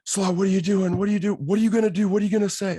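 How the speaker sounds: high, loud panicked questions; a friend freaking out in the cart. Retold or imitated. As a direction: voice jumps high and loud with alarm.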